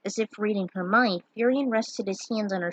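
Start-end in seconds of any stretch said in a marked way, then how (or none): none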